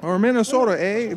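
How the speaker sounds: hammed-up Minnesota accent with a Canadian touch. Shown as a demonstration. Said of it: "close to a Canadian accent".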